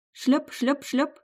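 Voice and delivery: high squelchy slap sounds; squelching footsteps. A narrator's reading. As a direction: mimic wet heavy steps. Imitating wet footsteps.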